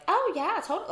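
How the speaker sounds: put-on high bubbly Valley Girl voice. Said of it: "Valley Girl voice".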